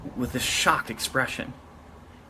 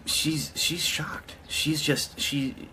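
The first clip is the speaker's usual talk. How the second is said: whisper; 5 semitones higher, 5 dB quieter, much slower than usual. Real, not acted. Dramatic aside about cashier's shock.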